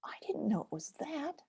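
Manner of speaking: a high "oh", then a hushed whisper of discovery; whispered little surprise; soft surprise. Pretend. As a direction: breathe it out as if it just dawned on you.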